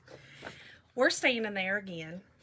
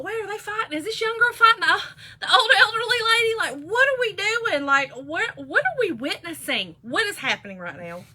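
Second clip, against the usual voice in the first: high, loud, rattled retelling; reliving the shock in rushed, raised voice; bewildered onlooker's outburst; alarmed disbelief; 9 semitones higher, 6 dB louder than usual. Retold or imitated. Retold alarmed reaction to the fight.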